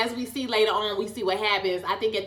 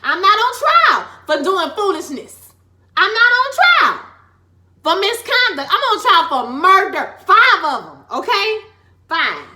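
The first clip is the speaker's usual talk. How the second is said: high, loud courtroom defense; pleading innocence like a defendant on the stand; impassioned loud plea; 8 semitones higher, 9 dB louder than usual. Acted. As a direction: plead your case to the jury at full volume. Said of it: "Okay, I know I got real loud".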